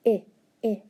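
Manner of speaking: "I" as the short vowel of inch, twice; two separate short "I" sounds, ih, ih. Shown as a demonstration. Teacher quizzing pupils on spelling sounds, short I. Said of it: "that's our short I".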